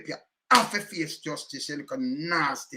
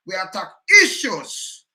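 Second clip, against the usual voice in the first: louder, slow We attack issues; emphatic; 3 semitones higher, 8 dB louder, slower than usual. Real, not acted. Declaring the show's mission, emphatic. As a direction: state the motto firmly. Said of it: "That's why I deal with issues".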